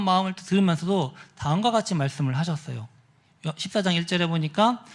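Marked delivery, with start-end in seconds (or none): none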